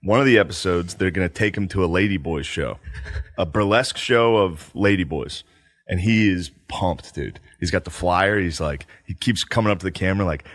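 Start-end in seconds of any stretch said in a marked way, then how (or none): none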